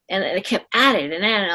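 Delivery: ordinary talk, nothing marked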